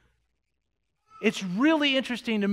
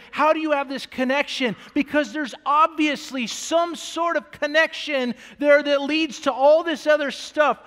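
fervent preaching; 5 semitones higher, 4 dB louder than usual. Real, not acted. Preaching at raised pitch.

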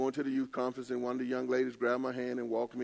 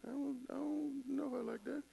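high baffled inner voice; 12 semitones higher, 10 dB quieter than usual. Retold or imitated. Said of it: "I'm like".

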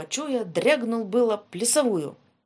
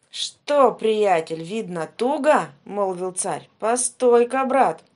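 menacing tsar; 9 dB louder, slower than usual. Acted. Tsar mocks frightened Ivan.

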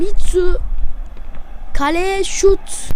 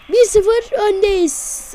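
ordinary delivery

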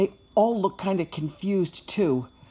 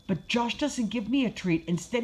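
ordinary delivery

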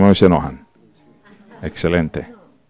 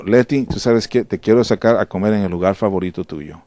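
softer sincere invitation; a hint of tender husband; gentle apology; 3 semitones lower, 5 dB quieter than usual. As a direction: coax her kindly. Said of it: "O le digo".

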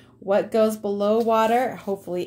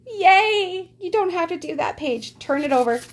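gleeful cheer; 10 semitones higher than usual. Real, not acted. Teacher freeing students from a least favorite page, gleeful cheer. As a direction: cheer it, pitched well up.

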